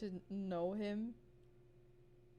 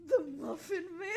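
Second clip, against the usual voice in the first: cracking voice; 8 semitones higher, 4 dB louder than usual. Real, not acted. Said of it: "we still got a voice box".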